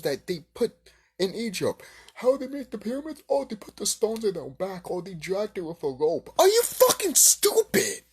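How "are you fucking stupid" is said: high, loud, slowed outraged yell; screaming are you stupid at them; furious shout. Real, not acted. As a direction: blow up in disbelief. Ranting at orc teacher claim.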